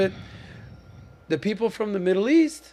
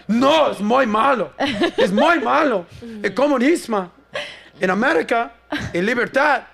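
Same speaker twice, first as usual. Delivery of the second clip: put-on Mexican accent for a Venezuelan; 4 semitones higher, 5 dB louder than usual. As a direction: imitate an indignant driver in a Mexican accent. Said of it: "I'm doing a Mexican accent for a Venezuelan".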